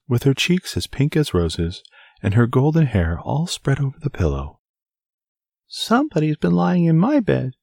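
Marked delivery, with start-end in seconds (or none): 5.7-7.5 very high, happy little squeak; joyful squeaky baby bear. A narrator's reading. Baby bear finding Goldilocks in bed, joyful squeak. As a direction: squeak it with joy like a tiny cub. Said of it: "squeaked the baby bear joyfully".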